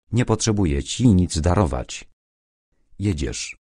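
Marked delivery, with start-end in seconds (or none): 3.0-3.6 quiet, final, falling; firm decision. Acted. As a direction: settle it, no arguing. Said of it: "To już postanowione".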